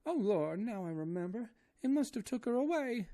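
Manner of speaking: high, loud wailing maid voice; terrified woman begging to die, high wailing; crying Esmeralda. A narrator's reading. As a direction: wail in despair with eyes shut. Esmeralda's voice held.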